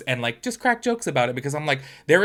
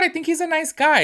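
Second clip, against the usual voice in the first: put-on high, sing-song take on her old opinion; mock naive; 14 semitones higher, 4 dB louder than usual. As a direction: play your past self, way up high. Quoting her earlier opinion.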